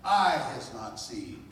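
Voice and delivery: loud, rising preacher's cadence; preaching. Real, not acted. Speaker preaching to the audience at full force. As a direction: build them up, hammering each line.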